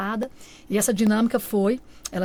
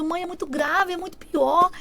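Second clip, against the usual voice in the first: retold tearful plea; 8 semitones higher, slower than usual. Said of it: "eu já entrei em pranto".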